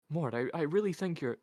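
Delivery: high, loud, stammering female character; flustered, high-pitched plea; nervous objection. A narrator's reading. Isabel nervously objects, cut off.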